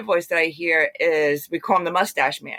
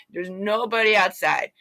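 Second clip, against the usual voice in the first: ordinary talk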